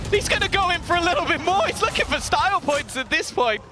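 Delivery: rising hype, pitch climbing high; excited casting. Real, not acted. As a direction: sell the highlight play with big energy. Caster hyping a one-man rampage.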